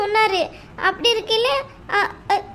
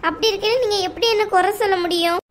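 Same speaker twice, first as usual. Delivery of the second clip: usual speaking voice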